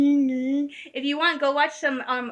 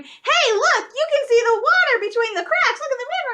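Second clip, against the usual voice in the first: high, loud, gleeful taunt; teasing kid; 11 semitones higher, 4 dB louder than usual. Retold or imitated. Daughter teases her scared mom on the old bridge, gleeful. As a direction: sing out the cracks to rattle mom.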